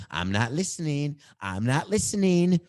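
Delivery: put-on high, loud, rising childish taunt; mock refusal to listen. Host tunes out producer in earpiece, stubborn rising chant. Tuning out producer in his ear.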